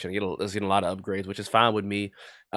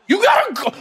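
much higher, louder urging yell; excited shout; 10 dB louder than usual. Real, not acted. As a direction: scream at the player to run. Urging player on.

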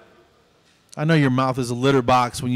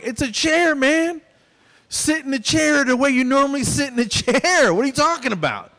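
exasperated, higher and pitched up; fed-up talk to a grown man acting like a toddler; frustrated dental patient scolding; 11 semitones higher than usual. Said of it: "Just frustrated".

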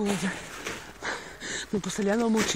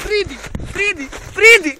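high, falling sing-out, last one louder; coaxing call; 7 semitones higher, 4 dB louder than usual. Acted. Presenter calling a creature to come, high coaxing call. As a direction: coax it over with a high call.

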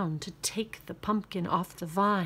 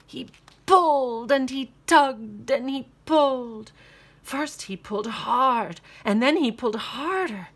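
higher and louder with effort; straining build; 5 semitones higher, 6 dB louder than usual. A narrator's reading.

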